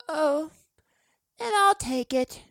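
lordly acceptance. A narrator's reading. The boy takes the mint from Fred like a lord. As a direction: accept it grandly, as if doing a favour. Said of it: "like a lord accepting his bread".

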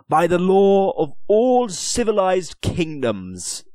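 high, loud, imperious Lord Deputy; haughty lord. Acted. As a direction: press him with lordly scorn. Lord Deputy lectures on law.

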